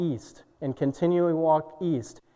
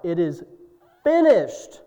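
shout of victory; 6 dB louder than usual. Acted. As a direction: cry out finished in triumph. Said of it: "with a great shout of victory, cried out".

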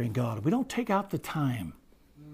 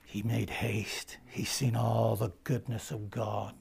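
slow hush; 4 semitones lower, 7 dB quieter, slower than usual. Real, not acted. Slow hushed retelling.

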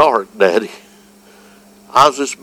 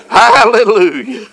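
preaching; 11 semitones higher, slower than usual. Acted. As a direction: preach it out with full fire. Preacher building to full fervor.

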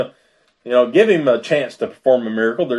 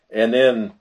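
ordinary delivery

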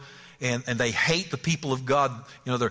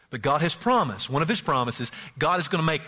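usual speaking voice

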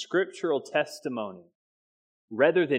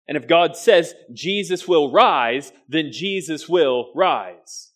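louder, then slowing on "will rise"; full-force preaching; 3 semitones higher, 7 dB louder, slower than usual. Acted. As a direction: proclaim it with rising conviction.

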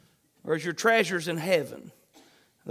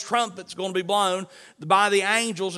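ordinary delivery

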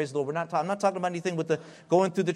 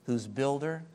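ordinary delivery

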